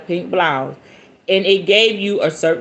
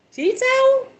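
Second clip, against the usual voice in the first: much higher, comic squeak; mock puzzled echo. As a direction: echo it way up high, baffled. Mocking repeat of odd word.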